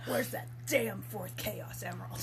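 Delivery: low, slow, brooding Shadow voice. Retold or imitated. Quoting the game's hero, low brooding mock voice. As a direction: drop low and growl it slowly like an edgy hero. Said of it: "he straight up just goes".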